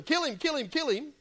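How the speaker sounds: high, urgent "kill him" chant; egging on a mob. Retold or imitated. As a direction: urge the crowd on. Paul urging the stoning.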